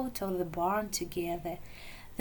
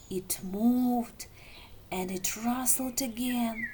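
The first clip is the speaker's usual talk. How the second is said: ordinary delivery, nothing marked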